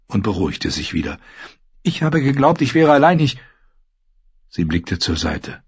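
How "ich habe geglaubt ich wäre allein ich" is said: put-on higher, fast girl's voice, defensive; young lady insisting she wasn't scared, pitched up; frightened Sansa. Sansa denying her fear to the Hound, high and quick.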